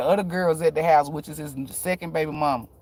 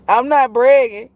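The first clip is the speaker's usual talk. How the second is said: louder, higher protest; insistent, raised-voice disclaimer; emphatic denial; 7 semitones higher, 9 dB louder than usual. Real, not acted. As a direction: protest it loud and high. Emphatic denial, then repeated softer.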